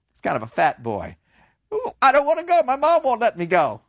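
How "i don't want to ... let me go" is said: very high, whiny refusal; reluctant boy. Retold or imitated. Kemerick refusing to enlist, high and whiny. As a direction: whine like a scared kid.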